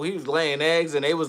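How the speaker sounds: usual speaking voice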